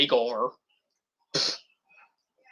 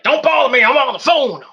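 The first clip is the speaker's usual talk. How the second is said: higher, louder dismissive wrestler voice; mock annoyed brush-off; 7 semitones higher, 4 dB louder than usual. Imitating Eli brushing people off, higher and louder. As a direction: shoo them off, higher and louder.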